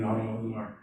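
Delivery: usual speaking voice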